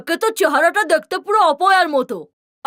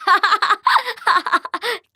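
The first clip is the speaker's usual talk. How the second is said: mocking laugh; 3 semitones higher than usual. Sister mocks cousin's request.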